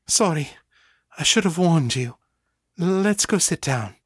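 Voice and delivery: put-on a little drunk, sheepish; Ava's voice. A narrator's reading. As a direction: Ava apologizing to Danny. Ava apologizing, Ava's voice.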